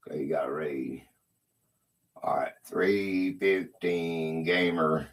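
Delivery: breathy whispered aside. Real, not acted. A streamer whispering to himself about a raid, in a whisper. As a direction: whisper it to yourself.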